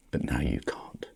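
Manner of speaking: sudden hushed whisper; whispered aside. Real, not acted. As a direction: drop to a whisper. Sudden drop mid rant.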